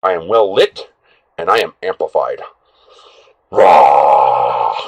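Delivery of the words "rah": playful roar. Pretend.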